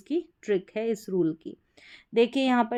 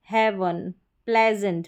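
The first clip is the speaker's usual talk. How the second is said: normal talking voice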